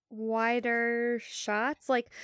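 ordinary delivery

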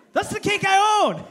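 high, loud possessive protest; hammed-up outrage; mock-indignant shout. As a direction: yell it like a jealous owner. Acts guarding his owned cake.

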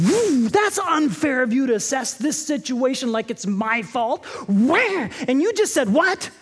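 hammed-up indignant protest, rising high; wounded pride voice. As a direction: act out offended pride, building to a high outburst. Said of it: "inside my pride went".

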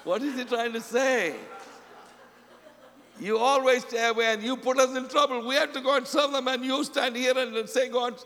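high-pitched acted grumbling; exasperated Peter. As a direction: complain like a fed-up disciple. Acting Peter complaining about Jesus.

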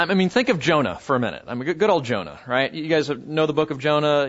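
ordinary talk

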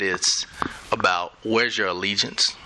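ordinary talk

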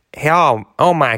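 put-on American accent. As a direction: put on an exaggerated American lady's voice. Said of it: "excuse the American accent, everybody".

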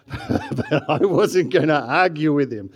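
loud, drawn-out words through a laugh; amused, belted-out admission. Real, not acted. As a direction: laugh it out to the crowd, slowly. Joking about questionable qualification, laugh heard.

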